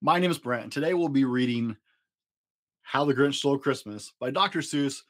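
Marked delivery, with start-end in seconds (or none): none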